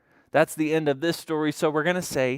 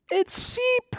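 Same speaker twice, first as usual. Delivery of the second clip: very high-pitched happy announcement; spring the surprise on little kids; excited reveal. Pretend. Storyteller revealing the animal to children, excited reveal.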